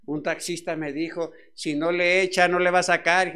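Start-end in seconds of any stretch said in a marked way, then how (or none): 1.5-3.3 loud, pushy cabbie voice; a hard-nosed cabbie shouting his terms; taxi driver. Retold or imitated. Taxi driver demanding payment, loud and pushy.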